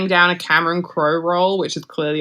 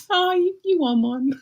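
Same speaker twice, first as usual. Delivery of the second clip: much higher, teasing reply; mock retort; 12 semitones higher than usual. Host teasing Brad about his Oscar, much higher and mocking. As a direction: lift the voice in playful mockery. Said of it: "And it's like".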